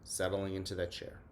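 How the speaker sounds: soft, unhurried breathing guide; guided meditation. Acted. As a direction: ease the class into calm, breath by breath. Leads a mindful minute.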